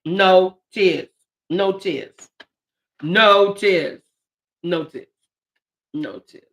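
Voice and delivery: fading plea to stop crying; holding back tears. Real, not acted. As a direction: talk yourself out of crying. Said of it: "So if I start crying".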